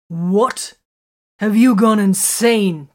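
loud, stunned outburst; shocked outburst. A narrator's reading. Sergeant bursts out at Vox's reckless counsel, shouting. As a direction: blurt it out in disbelief, loud and slow. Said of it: "Titus burst out".

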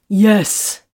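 loud, emphatic burst; relieved exclamation. A narrator's reading. Man relieved to hear her express this, exclaiming. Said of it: "Titus exclaimed".

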